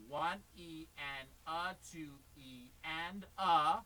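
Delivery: very slow, deliberate count; slow demo count. Shown as a demonstration. Teacher modelling the count slowly for the student to watch.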